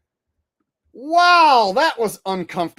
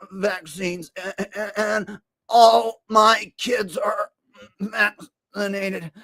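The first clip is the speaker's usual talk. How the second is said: high, halting, strained voice with stutters; RFK impression; 7 semitones higher than usual. Comic mocks RFK's testimony, strained stuttering voice. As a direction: stammer it out like a shaky RFK. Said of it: "R F K impression".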